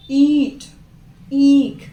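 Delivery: ईंट and ईख with the long ई pressed hard and loud; long ई with force. Shown as a demonstration. Teacher showing pupils the long ई, pressed loud and hard. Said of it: "ज़्यादा force दे रहे हैं बड़ी ई को बोलने पे".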